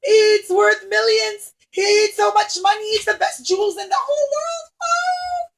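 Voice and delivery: very high, loud alarmed cries, slowed; dismayed crowd. Acted. Everybody cries out over the lost crown, alarmed. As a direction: gasp in horror. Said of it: "And everybody said".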